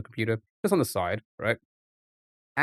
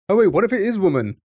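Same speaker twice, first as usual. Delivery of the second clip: higher, louder, fast burst of discovery; excited realisation; 8 semitones higher, 7 dB louder, faster than usual. Real, not acted. Solving a cryptic clue, suddenly gets it, excited. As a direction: blurt the idea out fast.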